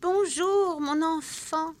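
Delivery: put-on high, quavering old woman; a sweet, trembling granny greeting. The disguised witch greets Margot, quavering. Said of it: "dit-elle d'une voix chevrotante".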